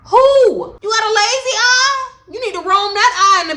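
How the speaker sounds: high, loud mock disbelief; incredulous retort. Talking back to the girl at the window, loud and high.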